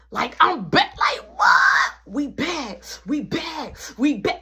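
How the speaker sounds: crowing that everything's back; thrilled to be back. Retold or imitated. Getting his phones back, shouting for joy. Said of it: "I was happy".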